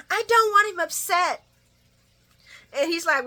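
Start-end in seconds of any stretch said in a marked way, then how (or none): 0.1-1.4 high, loud whiny voice; mock whiny plea. Vlogger mocking Esme's worried plea. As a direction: whine it in a mocking pitch. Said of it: "Esme pleads".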